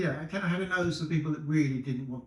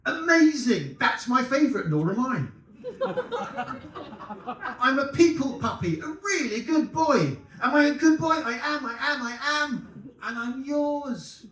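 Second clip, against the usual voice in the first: very high, loud giddy puppy voice; overjoyed dog; 10 semitones higher, 6 dB louder than usual. Acted. Dog gushing at the woman, very high and thrilled. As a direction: bounce with delight, begging to be called good. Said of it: "it's got two voices".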